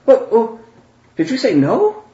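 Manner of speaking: high, incredulous questioning; startled awake. Acted. Wolfgang startled awake by the vote, high and surprised. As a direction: blurt it as if jolted from a doze. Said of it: "he's kinda startled awake".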